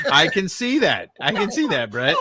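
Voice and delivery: amused friend teasing across the room; laughing. Real, not acted. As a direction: chuckle it out while teasing. Teasing reply amid laughter.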